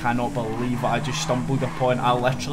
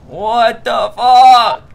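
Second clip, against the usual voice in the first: slow, drawn-out loud high disbelief; stunned shout; 9 semitones higher, 13 dB louder, much slower than usual. Real, not acted. Crashed rider shouting in disbelief, slow and loud. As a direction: stretch it out in shock.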